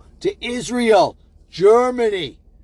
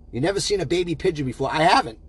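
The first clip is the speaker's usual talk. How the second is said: normal talking voice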